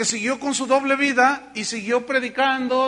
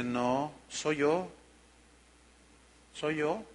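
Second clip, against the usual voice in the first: voice of God; 5 semitones lower, 9 dB quieter, much slower than usual. Retold or imitated. The Lord answers him.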